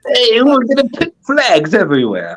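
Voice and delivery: mock British accent leaning too hard on every word; a choppy, over-stressed British put-on; overdone British accent. Mocking Brits planting flags, over-stressed British accent. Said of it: "Too much emphasis on the words".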